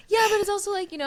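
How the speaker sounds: awkward self. Retold or imitated. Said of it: "I'm like".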